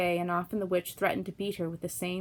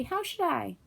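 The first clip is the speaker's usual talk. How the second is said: 1 Dorothy; 9 semitones higher, slower than usual. A narrator's reading. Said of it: "answered Dorothy".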